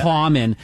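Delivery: "common" with a heavy aw in the first syllable; exaggerated movie-Boston "cawmon"; vowel hit too hard. Shown as a demonstration. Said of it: "when it's hit too hard".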